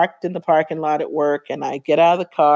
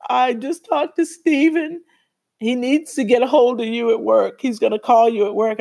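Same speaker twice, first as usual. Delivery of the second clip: upset friend; 7 semitones higher than usual. Retold or imitated. Friend passing on that the doctor will call. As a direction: deliver news you can't bear to say. Friend voiced with bad news.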